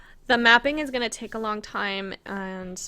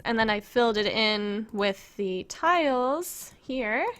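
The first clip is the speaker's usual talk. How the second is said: ordinary talk, nothing marked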